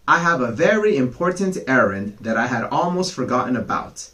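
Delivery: fast, rushed excuse; hurried excuse. Acted. Fox making up an errand, rushed. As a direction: gabble it out to get away.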